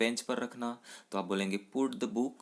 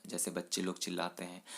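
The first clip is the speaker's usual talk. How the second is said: usual speaking voice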